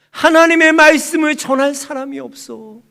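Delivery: loud, high acted lament; anguished outcry. God lamenting to Hannah, loud anguished outcry. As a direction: burst out with it as God's complaint.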